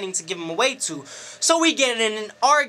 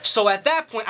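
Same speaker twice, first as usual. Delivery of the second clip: ordinary talk, nothing marked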